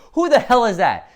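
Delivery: loud, high, incredulous question; baffled outburst. Acted. Comic reader reacting to a weird guy from a portal, baffled. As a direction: blurt it out in confusion.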